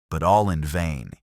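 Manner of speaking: usual speaking voice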